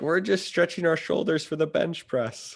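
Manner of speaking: mock innocent kids, pitched high; high-pitched kid excuse, retold; imitating kids. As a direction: lightly imitate youngsters making an excuse, voice lifted. Retelling young lifters' excuse.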